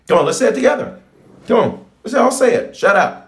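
high-pitched, urgent call to join in; rallying the congregation. Real, not acted. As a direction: whip the crowd up to say it together. Rallying congregation to chant together.

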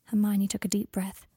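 plain reading voice

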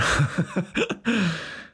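short high laugh; amused burst of laughter. Real, not acted. Laughs after mock threat.

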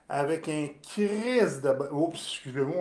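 ordinary talk, nothing marked

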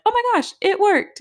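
high, loud burst of joy; overjoyed shriek at good news; thrilled squeal. Retold or imitated. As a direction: squeal it like you can't believe it worked. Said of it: "so excited at this point".

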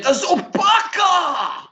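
slow, booming play-by-play yell; Kevin Harlan call. Retold or imitated. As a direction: belt it like a broadcaster calling a huge dunk. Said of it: "And our boy Kevin Harlan said".

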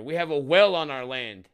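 loud imagined telling-off; pretend defiance. Imagined cop refusing the governor, heated loud defiance. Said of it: "I would be like".

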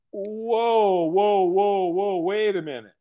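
drawn-out whoas settling into a halt; dawning alarm. Retold or imitated. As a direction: put the brakes on the celebration. Acting out sudden realisation.

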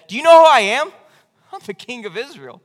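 haughty boast, first line loud and high; proud king. Retold or imitated. Imagined David boasting to God, haughty and loud. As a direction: puff up like an offended king.

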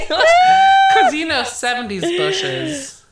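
high loud laugh bursting out; laughing shout. Real, not acted. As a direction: blurt it through a big laugh. Laughing at own joke.

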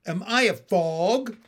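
insistent character question, voice lifted. Acted. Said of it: "he said louder".

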